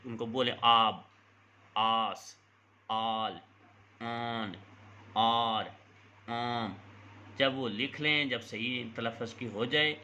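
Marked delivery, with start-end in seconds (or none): 0.6-6.7 each word said on its own, clearly, with pauses; dictation words. Shown as a demonstration. Teacher dictating short words to children, clear and separate. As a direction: read them out as if for dictation. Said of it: "بچوں کو بولیں".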